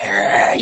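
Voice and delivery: low guttural "ugh" of resentment; seething growl. Acted. Coach acting out resentment to a client, seething growl. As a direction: growl out the frustration. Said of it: "You're seething".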